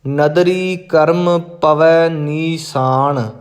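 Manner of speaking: ਨੀਸਾਨ said with a plain s, not sh. Shown as a demonstration. Teacher warns against ਨੀਸ਼ਾਨ, careful pronunciation. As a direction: say nee-saan, no sh sound. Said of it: "ਇਹਨੂੰ ਨੀਸਾਨ ਪੜ੍ਹਨਾ ਹੈ".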